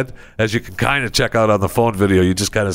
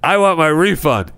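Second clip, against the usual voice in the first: demanding customer; 8 semitones higher, slower than usual. Retold or imitated. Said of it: "he just turns his camera and goes".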